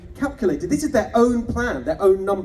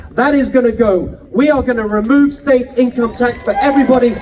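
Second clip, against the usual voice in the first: louder, punchy campaign oratory; rousing rally speech; 6 dB louder than usual. Acted. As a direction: drive every promise home to the crowd. Rally speech climax at full force.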